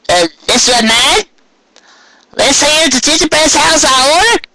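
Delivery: a chirpy country grandma; old Granny Smith voice. Acted. Granny Smith inspects order.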